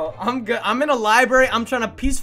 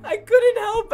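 laughing; slower than usual. Real, not acted. Streamer to chat, still laughing while retelling. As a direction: still laughing, words squeezed out. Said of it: "I was laughing so much".

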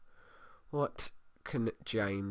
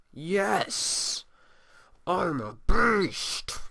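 high, slow, drawn-out boast; mock triumphant sim; 8 semitones higher, 4 dB louder, much slower than usual. Gamer voicing a sim gloating about his bed, high slow boast. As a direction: crow it like a proud little sim.

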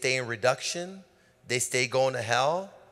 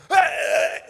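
deep voice; 8 dB louder than usual. Retold or imitated. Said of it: "I do a deep voice".

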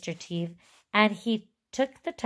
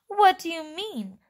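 higher, mildly sharp question; 10 semitones higher, 4 dB louder than usual. Acted. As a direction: ask it sharply, pitched up. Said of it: "asked Mister Teeve sharply".

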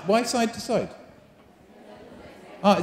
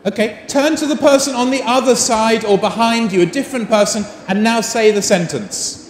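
raised teacher voice over chatter; calling out; 4 semitones higher than usual. Real, not acted. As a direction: call the class to order across the room.

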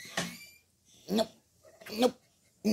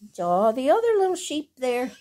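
usual speaking voice

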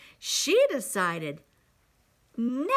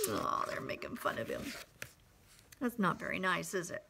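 fast, lower confiding whisper; whispered aside; 6 semitones lower, 9 dB quieter, much faster than usual. Real, not acted. Storyteller aside to children.

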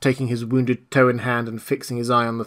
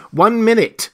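raised, loud interjection; tremulous self-control; 10 semitones higher, 7 dB louder than usual. A narrator's reading. The voice to a raving Marvel, tense self-control. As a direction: cut in with strained self-control. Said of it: "tremulous with self-control".

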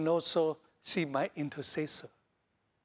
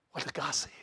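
whisper; 3 semitones lower, 3 dB quieter, faster than usual. Acted. Sermon question, hushed.

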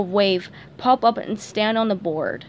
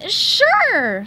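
much higher, voice breaking nervously; squeaky nervous crack; 10 semitones higher, 5 dB louder than usual. Acted. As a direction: let the voice crack on the lie. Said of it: "his voice cracking a little".

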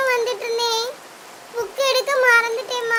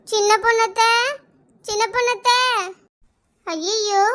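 ordinary talk

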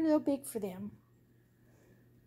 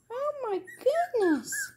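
high cooing; 8 semitones higher, slower than usual. Real, not acted. Cooing at puppies, repeated.

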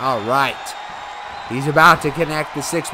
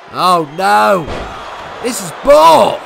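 frustrated outburst; 3 semitones higher, 5 dB louder, slower than usual. Real, not acted. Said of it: "I'm frustrated".

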